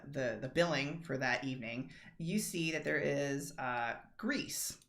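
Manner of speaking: ordinary talk, nothing marked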